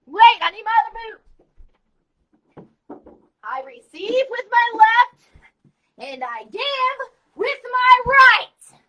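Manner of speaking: booming drill sergeant bravado; loud gung-ho soldier. Shown as a demonstration. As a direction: bark it like a pumped-up soldier. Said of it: "Let me demonstrate".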